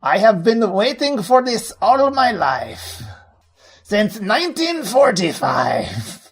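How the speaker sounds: high, hammy fake German accent; bad German accent. Co-host jokes as a Nazi collector in bad German accent. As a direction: ham up a German villain accent. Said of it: "wasn't even close to a German accent".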